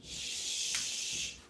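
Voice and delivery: long unvoiced shush to quiet the bird; a long soft shh aimed at a noisy parrot. Real, not acted. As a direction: hush the room with a drawn-out shh. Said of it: "I'd like someone to hush first".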